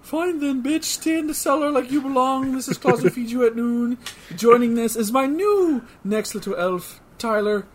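high, bossy Santa voice; bossy Iggy Claus. Acted. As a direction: boss the elves around like Santa. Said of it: "Iggy Claus".

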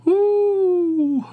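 high, loud whoop of laughter. Real, not acted. Laughing at Chick-fil-A claim.

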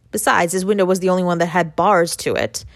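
plain reading voice

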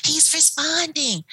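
high and slow, stretched with conviction; eager insistence. Retold or imitated. Aide insisting to the doubtful nurse, slow and excited. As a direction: insist he's responding, beaming.

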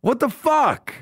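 very high, frantic demand; acted frustrated outburst, pitch through the roof; mock exasperation. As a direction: squeal the question in comic outrage. Retold demand for who has wallet.

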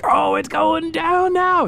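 very high, slow excited exclamation; gleeful anticipation. Acted. Onlooker as the FBI reveals itself, gleeful anticipation. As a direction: draw it out high with relish. Listener's imagined excited reaction.

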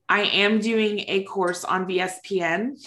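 ordinary delivery

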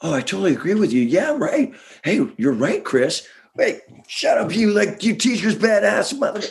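high, fast sarcastic agreeing; toady up, then snap; mock fawning. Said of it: "we're like".

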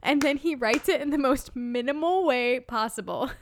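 high, loud laughing talk; real amused laughter. Real, not acted. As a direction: giggle through the explanation. Laughing over Michael's note.

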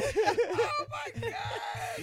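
slow high shout through laughter; laughing shout. Real, not acted. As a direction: cry out while cracking up. Reacts to joke, laughing.

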